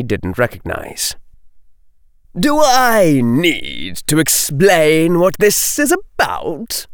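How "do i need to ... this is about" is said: higher-pitched, falsely jovial bully; false joviality. A narrator's reading. Bully Lee addresses cornered girls, falsely jovial. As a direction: put on a jolly tone you don't mean. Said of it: "Lee said with false joviality".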